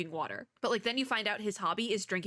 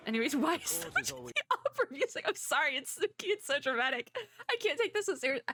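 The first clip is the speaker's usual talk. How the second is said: laughing; 7 semitones higher than usual. Real, not acted. Amused by dramatic opera music.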